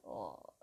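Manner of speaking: a breathless whispered sigh. Acted. The raven sighing over his lot, whispered sigh. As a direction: let the sigh slip out on air. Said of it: "volvió a suspirar".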